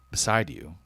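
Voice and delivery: usual speaking voice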